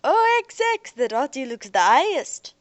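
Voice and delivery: put-on loud, high, slangy street-kid voice; cheery young Earther. Young man teases the spacers, loud and high-pitched. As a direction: keep the young man's jaunty, loud banter going.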